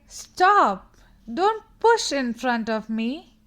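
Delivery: loud, firm call to stop pushing in line. Shown as a demonstration. Girl in the line tells the pusher to stop, loud and firm. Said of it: "She could say".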